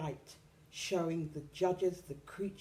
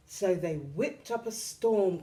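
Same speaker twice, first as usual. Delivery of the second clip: usual speaking voice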